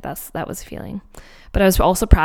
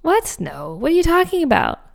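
stunned disbelief; 8 semitones higher than usual. Retold or imitated. Frozen by her friend's offer, disbelieving. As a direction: balk in disbelief, pitch leaping. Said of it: "It was, like, paralyzed".

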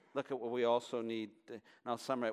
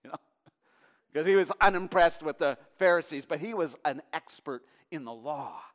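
usual speaking voice

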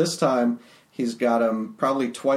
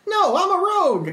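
high character voice; 3 dB louder than usual. Acted. S0 voicing Modron character.

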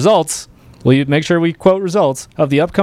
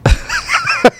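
high shrieking laugh. Real, not acted. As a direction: howl with disbelieving laughter. Co-host laughs again in disbelief.

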